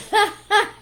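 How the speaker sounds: high, loud burst of exasperated laughter; real exasperated laugh; frustrated laugh. Real, not acted. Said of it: "you see, I'm frustrated".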